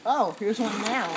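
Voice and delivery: ordinary talk, nothing marked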